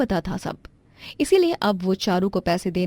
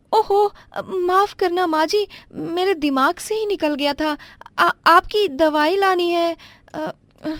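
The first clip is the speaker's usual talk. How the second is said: pitched well up, flustered daughter-in-law apologizing; a higher young wife caught out, stammering; 10 semitones higher than usual. Acted. Daughter-in-law forgot medicine.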